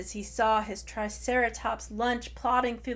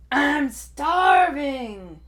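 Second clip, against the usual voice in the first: slow booming dinosaur roar, far louder; 6 semitones higher, 12 dB louder, much slower than usual. Acted. Hungry Tai roars at the swamp, booming slow roar. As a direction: bellow it out like a hungry T. rex. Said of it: "roared Tai".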